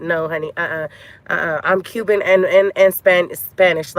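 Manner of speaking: normal talking voice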